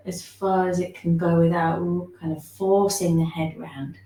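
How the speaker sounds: usual speaking voice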